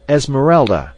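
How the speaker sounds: loud, high shriek of her name. A narrator's reading. Clayton shrieks in the fainted maid's ear, loud shriek. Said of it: "he shrieked in her ear".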